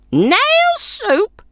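high, snorting scornful crone; scornful old woman. A narrator's reading. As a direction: scoff at the idea. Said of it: "snorted the old woman".

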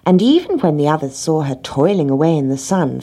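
usual speaking voice

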